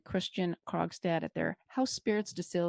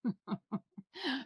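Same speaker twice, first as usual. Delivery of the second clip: laugh; 7 semitones higher, 7 dB quieter than usual. Real, not acted. Joke reaction.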